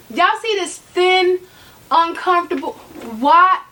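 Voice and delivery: rising disbelief building to why; fed up with a flimsy strap; exasperated. Real, not acted. Insulted by the strap.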